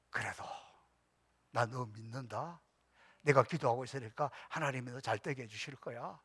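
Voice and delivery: soft, low trusting words; gentle reassurance. Retold or imitated. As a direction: speak to the son softly, with faith. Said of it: "마음에 안 들어도". (아들에게 부드럽고 낮게 믿음을 전하는 말투)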